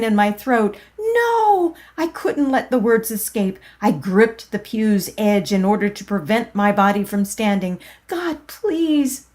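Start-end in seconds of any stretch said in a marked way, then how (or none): 1.0-1.7 high, loud refusal; desperate no. Acted. Narrator refusing the force pushing her up, high loud cry. Resisting the urge to stand. 8.1-9.3 high, pleading cry; inward desperate plea. Acted. Narrator begging God in the pew, high pleading cry. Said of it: "I inwardly cried".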